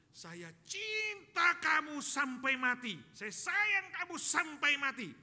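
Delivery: loud, high, slow and drawn-out; exasperated love declaration. Acted. Husband finally answering his nagging wife, exasperated shout. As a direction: belt out each word with hammed-up insistence.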